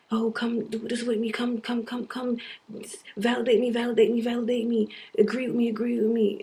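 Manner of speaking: a hint of begging, mocking her own neediness; mock pleading. Mocking herself for begging others to agree with her, lightly whiny. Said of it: "I'm fucking sitting here trying to".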